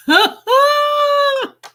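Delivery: high, loud burst of laughter; loud laughing shriek. Real, not acted.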